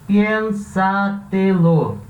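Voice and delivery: "Piénsatelo" very slow, with each syllable held apart. Shown as a demonstration. Teacher splitting a Spanish word for students, syllable by syllable. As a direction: break "Piénsatelo" into four drawn-out syllables. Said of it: "Parando as sílabas".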